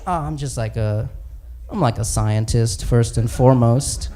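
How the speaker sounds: low, halting, embarrassed confession; Hulk. Acted. Hulk admitting his powers to the other heroes, low and sheepish. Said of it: "he's talking to Thor and Captain America".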